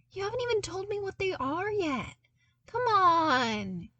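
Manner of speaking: high, fast, teasing whine; playful whine. A narrator's reading. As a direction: whine playfully at your friend. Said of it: "he whined playfully".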